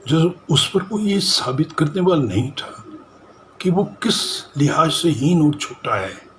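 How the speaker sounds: high-pitched, emphatic reproach; impassioned accusation. Acted. Acted monologue defending a belittled man.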